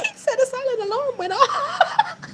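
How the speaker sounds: high, laughing through the words. Real, not acted. Narrator laughing remembering the robbery. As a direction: giggle it out.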